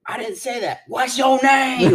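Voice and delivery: high, slow demanding voice; mimicking the inmate. Imitating an inmate pressing the officer for a name, high and slow.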